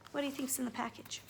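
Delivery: ordinary delivery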